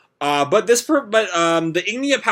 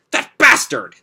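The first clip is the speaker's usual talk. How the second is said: furious shout; 10 semitones higher, 5 dB louder than usual. Acted. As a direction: snarl it out loud and high. Natsu furious at villain.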